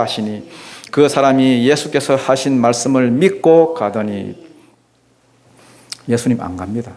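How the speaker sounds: normal talking voice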